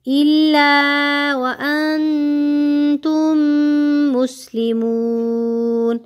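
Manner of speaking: slow, melodic tajweed chant; teacher reciting verse slowly, melodic chant; Quran recitation. Shown as a demonstration. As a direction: draw out each vowel in recitation.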